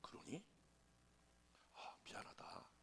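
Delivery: hushed, whispery retold apology; taken-aback apology. Pastor apologises to a hurt member, hushed and taken aback. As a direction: drop to a sheepish near-whisper. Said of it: "내가 깜짝 놀라가지고".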